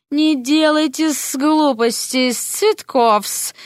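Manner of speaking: slow, high, sly snake-woman drawl with hissed endings; hissing snake lady. Acted. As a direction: purr it out like a scheming serpent. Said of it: "бросил Серпентина".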